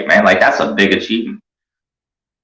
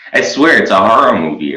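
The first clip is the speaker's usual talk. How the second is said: ordinary talk, nothing marked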